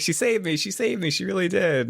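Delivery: put-on high-pitched gushing child; breathlessly insistent little kid voice; excited kid. Rescued kid gushes to his dad.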